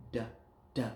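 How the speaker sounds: "D" as a short "duh", alone; isolated last sound. Shown as a demonstration. Teacher isolating the end of a word, short "D". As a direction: tap out just the consonant "D". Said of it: "What's that last sound?".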